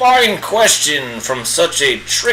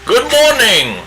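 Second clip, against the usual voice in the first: higher, hammed-up British old wizard; bad Gandalf impression; 7 semitones higher than usual. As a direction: play a pompous old wizard, badly on purpose.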